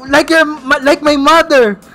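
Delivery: high, drawn-out campy outburst; hammed-up effeminate. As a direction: wail it like a hurt, effeminate tough guy. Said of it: "effeminate siya, naging".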